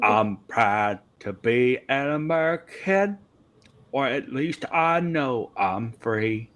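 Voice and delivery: put-on hammy patriotic American accent, slowing at the end; fake American accent. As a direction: wildly fake American twang. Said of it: "It is wildly fake".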